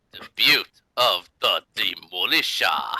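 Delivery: slow dramatic title read; title announcement. Acted. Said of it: "announcing episode title".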